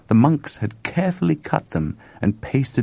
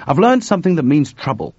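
higher, louder urgent warning; 7 semitones higher, 6 dB louder than usual. A narrator's reading. Chuck tells George he has found trouble, urgent. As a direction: break troubling news urgently. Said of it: "said Chuck urgently".

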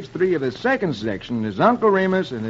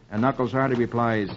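ordinary delivery, nothing marked

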